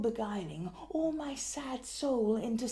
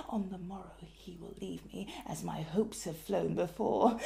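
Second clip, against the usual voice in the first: muttered; 5 semitones lower, 6 dB quieter than usual. Acted. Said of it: "scarcely more than muttered".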